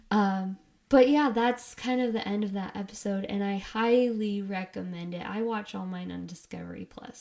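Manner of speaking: usual speaking voice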